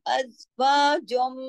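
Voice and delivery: slow अज़वाजुम, humming on the final m; long ghunna. Shown as a demonstration. Teacher models the merger, अज़वाजुम with nasal hum. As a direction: recite slowly and hold the m in the nose. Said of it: "देर तक आवाज को नाक में छुपाने".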